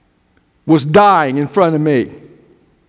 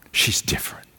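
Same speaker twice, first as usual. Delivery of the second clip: whispered repeat; 11 dB quieter than usual. Acted. Preacher drops voice about granddaughter.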